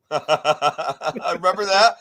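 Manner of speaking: laughing through the words, slow; amused, laughing reminiscence. Real, not acted. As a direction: chuckle it out as you remember. Laughs while recalling the story.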